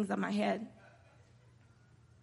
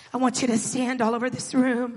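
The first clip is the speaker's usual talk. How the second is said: ordinary talk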